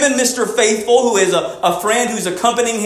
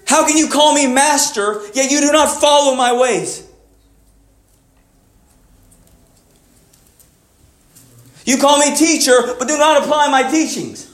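raised, insistent rebuke; cutting challenge; 5 semitones higher than usual. Retold or imitated. Preacher voicing Jesus challenging his followers, insistent and confronting. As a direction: press each accusation home in a higher pitch. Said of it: "he hits it where it hurts".

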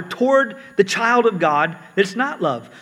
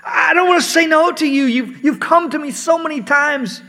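raised, emphatic pledge to the Lord; believer answering God; 7 semitones higher, 4 dB louder than usual. Acted. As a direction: act out a willing believer's fervent reply. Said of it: "and you say".

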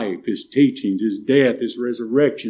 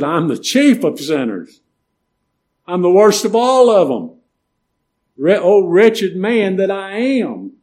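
raised, high-pitched pulpit cry; impassioned preached confession; 8 semitones higher, 7 dB louder than usual. Retold or imitated. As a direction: voice Paul's lament with mounting preacher's fervour. Preacher voices Paul's anguished confession.

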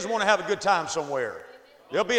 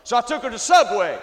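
ordinary delivery

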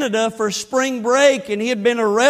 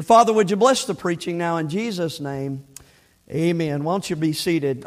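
normal talking voice

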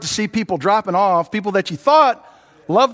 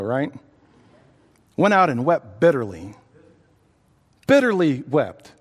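ordinary talk

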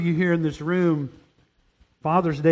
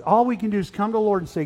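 ordinary delivery

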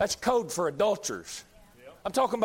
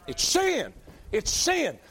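preached shout; 8 semitones higher, much slower than usual. Acted. Shouted repeat in sermon.

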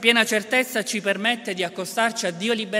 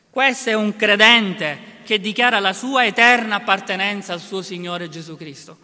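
ordinary talk

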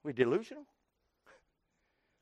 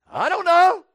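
mock angry reply; 10 semitones higher, 8 dB louder than usual. Angry person quoted, mock reply.

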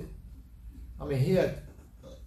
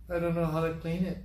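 mock helpless kid, lightly put on; pathetic; 2 semitones higher than usual. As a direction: imitate a pitifully clueless child. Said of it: "he said this, "Pathetic".

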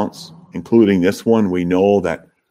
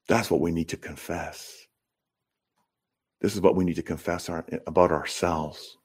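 much softer and lower, nearly under the breath; hushed, solemn; 3 semitones lower, 11 dB quieter than usual. Real, not acted. Preacher urges congregation to confess, hushed and solemn. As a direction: drop right down into a grave, low hush.

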